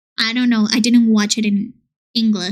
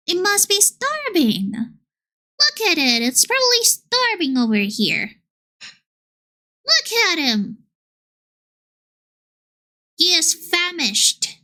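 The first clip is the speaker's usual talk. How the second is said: put-on pitying baby talk; 6 semitones higher than usual. Streamer to her hungry wisp, high pitying coo. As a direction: coo at it like a starving puppy.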